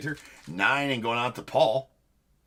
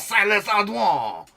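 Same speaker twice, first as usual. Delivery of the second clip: hammed-up French accent on Silas Ardouin; mock French; 12 semitones higher, 7 dB louder, slower than usual. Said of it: "Can I say Silas Ardouin".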